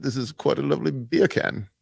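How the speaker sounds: soft, politely put-on British; British accent. Shown as a demonstration. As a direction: say it quietly as a mannered Brit. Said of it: "Say beer can in a British accent".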